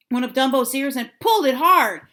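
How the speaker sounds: ordinary delivery, nothing marked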